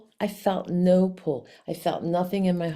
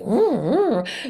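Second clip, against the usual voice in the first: loud trilled cat purring; exaggerated mock purr; imitated double purr; 5 semitones higher, 6 dB louder than usual. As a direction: roll out two big purrs. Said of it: "double purring".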